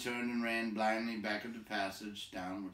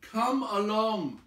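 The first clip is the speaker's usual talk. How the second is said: louder, slow, higher call; shouting; 12 semitones higher, 10 dB louder, much slower than usual. Acted. Bilbo shouting at Gollum to come along. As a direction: bark it down the tunnel. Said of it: "he shouted".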